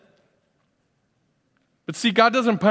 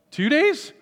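high, disbelieving "two days"; incredulous question; 11 semitones higher than usual. Real, not acted. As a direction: scoff at the guess. Joking guess questioned incredulously.

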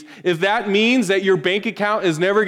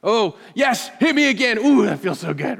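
hammed-up relish, lightly higher; a hint of a gleeful masochist asking for more; preacher mocking a misreading of Peter with fake pleasure; mock sadomasochist; 5 semitones higher, 3 dB louder than usual. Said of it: "as if Peter was saying".

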